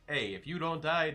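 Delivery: usual speaking voice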